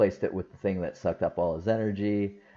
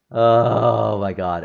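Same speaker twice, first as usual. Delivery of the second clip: drawn-out gushing; 9 dB louder, much slower than usual. Real, not acted. Gushing over favourite scene.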